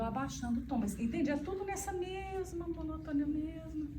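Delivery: ordinary talk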